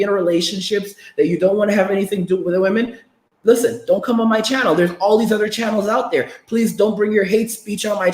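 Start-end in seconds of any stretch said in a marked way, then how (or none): none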